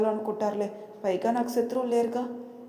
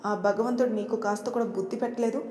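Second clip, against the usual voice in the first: ordinary delivery, nothing marked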